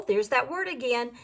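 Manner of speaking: ordinary delivery, nothing marked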